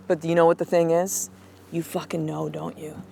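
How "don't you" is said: normal talking voice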